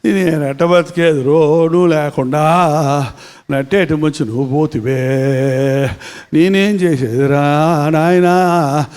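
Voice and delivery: slow, low sung-out keening for a dead husband; widow's wailing lament. Retold or imitated. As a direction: mimic a village widow's drawn-out mourning cry. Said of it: "ఇంకా రాగాలు బాగా తీసేది నాకు రావట్లే ఏడుపు". (గ్రామీణ విధవ రాగం తీసి ఏడుపు అనుకరణ)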